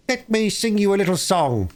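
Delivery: high, smug weasel voice; smug announcer. Acted. Chief Weasel announcing his song to the hall, high and smug. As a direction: announce it like a show-off.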